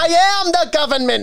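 high, loud New Jersey accent. Shown as a demonstration. Showing a friend again, loud New Jersey accent. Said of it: "I want you to do New Jersey".

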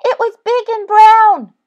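very high, loud mouse voice; panicked little mouse; crying mouse. A narrator's reading. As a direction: squeal it out as a frightened mouse. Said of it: "cried the mouse".

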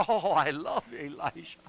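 usual speaking voice

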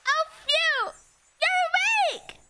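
high-pitched fairy squeal. Acted. Streamer testing a voice for the game's fairy, fairy voice. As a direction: try out a high little fairy voice. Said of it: "What was my voice for fairies again?".

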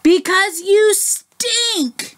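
very high, drawn-out mock shout; hammed-up high bear's outcry; storybook shout. A narrator's reading. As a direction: wail the accusation slowly, stretching every word. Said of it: "shouted the Big Fluffy Bear".

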